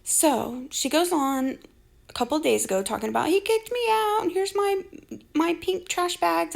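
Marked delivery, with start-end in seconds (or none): 3.3-6.5 mocking quote.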